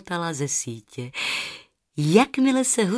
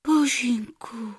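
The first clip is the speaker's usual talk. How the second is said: hushed, then gently soothing, higher; 4 semitones higher, slower than usual. Acted. Rozárka soothes the trapped golden goose, hushed and tender. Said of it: "řekla Rozárka konejšivě".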